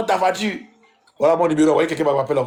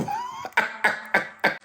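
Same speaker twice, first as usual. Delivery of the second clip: laugh; 8 dB quieter than usual. Real, not acted. Laughs after teasing word.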